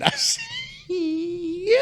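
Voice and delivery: high laughter breaking the words; helpless laughing; laughing fit. Real, not acted. Laughing while retelling the reply to coworker.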